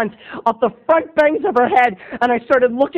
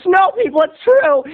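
insistent; 10 semitones higher, 4 dB louder than usual. Real, not acted. Insisting to audience.

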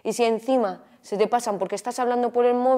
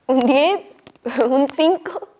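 entre risas; 6 semitones higher, 9 dB louder, slower than usual. Acted. Bromea en cinta de despedida.

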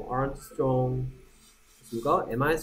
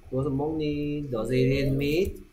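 each word drawn out slowly for the class; slow model question. Shown as a demonstration. Teacher modelling the question slowly for students, rising on "meat". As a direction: spell out "Does it eat meat?" very slowly, rising on "meat".

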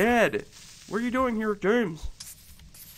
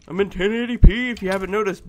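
ordinary delivery

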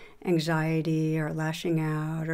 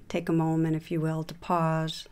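calm, even voice with long pauses between phrases; guided meditation; 4 dB quieter than usual. Acted. Teacher leading listeners through a meditation on shame, guided meditation voice. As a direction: guide them gently inward, leaving room to settle.

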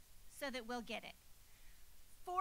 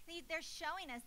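ordinary talk, nothing marked

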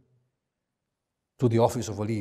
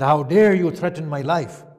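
louder, higher outraged rebuke; indignant outcry; 4 semitones higher, 8 dB louder than usual. Acted. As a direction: fling the challenge back at him. Imam startled, confronts Marwan.

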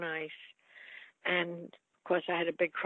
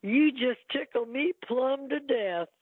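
lightly voiced Henry Fonda, slow and a bit higher; quoting Fonda's line; 5 semitones higher, 4 dB louder, slower than usual. Retelling Fonda's movie line to the interviewer, slow and fond. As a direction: drawl it out fondly, like the old co-star. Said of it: "He used to say".

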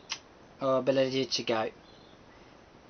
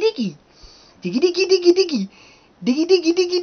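high sing-song calling, getting louder; coaxing pet birds; 11 semitones higher, 7 dB louder than usual. Real, not acted. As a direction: coax the shy bird in, high and bright.

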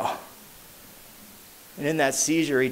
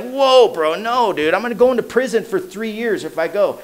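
much higher, panicky refusal; alarmed protest; 6 semitones higher than usual. Retold or imitated. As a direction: recoil at the idea of prison. Panics about going to prison.

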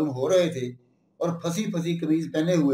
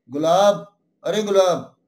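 raised call, louder on the name; shouting out; 2 semitones higher, 5 dB louder than usual. A narrator's reading. Villain shouts to Gulab from the window, calling out. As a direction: call after someone walking away. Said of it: "खिड़की में से गर्दन बाहर निकालकर चिल्लाए".

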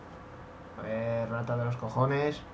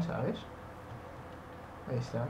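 ordinary delivery

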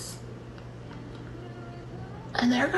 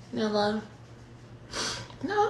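ordinary talk

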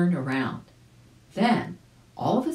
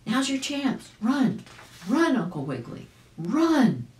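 urgent call; 8 semitones higher than usual. A narrator's reading. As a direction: call out so he runs now. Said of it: "called Fido Flip Flop".

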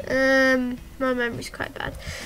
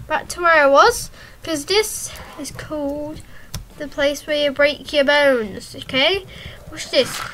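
ordinary talk

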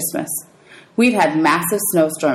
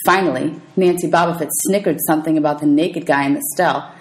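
usual speaking voice

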